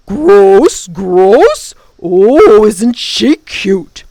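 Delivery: loud mocking creature voice; simpering sneer. A narrator's reading. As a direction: mimic her loudly with fake sweetness. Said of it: "The creature simpered and sneered".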